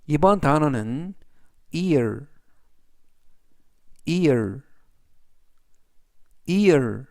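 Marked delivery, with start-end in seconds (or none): 1.7-7.1 "ear" said clearly, each take after a pause; teacher modelling "ear" for students to repeat; careful "ear", set apart from "here" with no h; model pronunciation. Shown as a demonstration. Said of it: "이라고 발음하시면 되겠고요".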